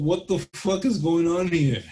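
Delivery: loud, high disbelief; shocked. Retold or imitated. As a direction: blurt it out stunned. Retold own shocked reaction.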